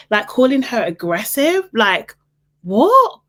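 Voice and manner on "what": high, loud, rising yelp; incredulous. Real, not acted. Viewer reacting to him calling her aggressive, incredulous yelp. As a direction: yelp it in disbelief.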